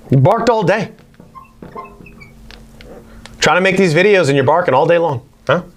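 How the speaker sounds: high, playful scolding of the dog; talking to the dog. Real, not acted.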